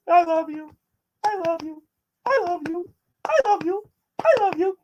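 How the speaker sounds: put-on high childlike voice, repeating it over and over; mock the little boy's clingy sing-song; imitating the youngest kid. The youngest kid pestering dad with love, high childish imitation. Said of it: "The youngest one would come to me and go".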